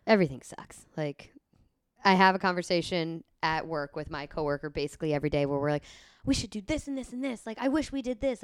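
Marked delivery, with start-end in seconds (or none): 6.2-8.4 higher-pitched imitation of keen brainstorming; mock eager pitching. Coworkers pitching ideas to each other, mock eager. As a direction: rattle off ideas in a put-on keen voice.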